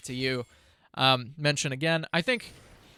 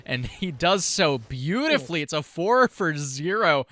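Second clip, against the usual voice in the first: play-by-play; 4 semitones higher, 5 dB louder than usual. Acted. Live play-by-play of a fight.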